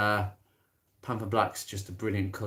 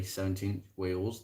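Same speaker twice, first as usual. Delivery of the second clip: normal talking voice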